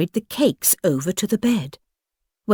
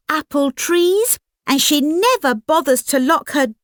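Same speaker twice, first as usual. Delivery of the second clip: put-on higher, fast eager girl's voice; chatty little girl; 8 semitones higher than usual. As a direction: gush it out quickly like an excited child.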